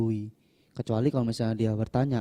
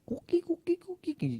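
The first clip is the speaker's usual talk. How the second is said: very high-pitched silly little voice; mock goofy falsetto; goofy, careless character; 3 dB quieter than usual. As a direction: squeak it out like a goofball. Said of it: "tampangnya selenge-selengehan gitu kan".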